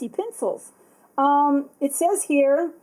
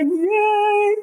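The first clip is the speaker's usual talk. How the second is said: mock yay; 9 semitones higher, 5 dB louder than usual. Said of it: "I'm like".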